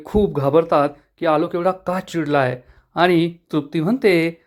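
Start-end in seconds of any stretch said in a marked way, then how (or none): none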